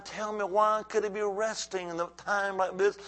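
ordinary talk

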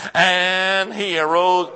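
loud, slow proclamation; shout. Shown as a demonstration. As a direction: shout it out, stretching the words. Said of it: "Come on, shout that, please".

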